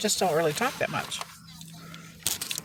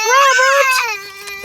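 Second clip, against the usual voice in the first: much higher, loud drawn-out call; 8 dB louder than usual. Real, not acted. Mother calling to unhappy baby Robert. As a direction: call his name over the crying.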